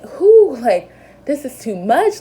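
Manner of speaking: overwhelmed outburst. Retold or imitated. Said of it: "I'm sobbing".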